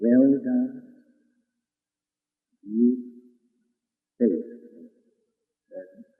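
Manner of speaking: soft slow fade to a hush; softly fading words of praise; tender fading blessing. Acted. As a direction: let it die away gently.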